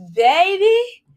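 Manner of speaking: high, loud delighted cry; excited exclamation. Real, not acted. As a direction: call it out like a craving hitting. Exclaims about ice cream.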